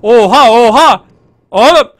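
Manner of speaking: drawn-out, loud exclamation; loud astonishment. Real, not acted. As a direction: blurt it out in disbelief, loudly. Startled by events in game. (yüksek sesle şaşkınlık)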